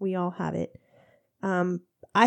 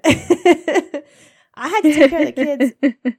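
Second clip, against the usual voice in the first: laughing; 6 semitones higher, 9 dB louder, slower than usual. Real, not acted. Laughing retelling.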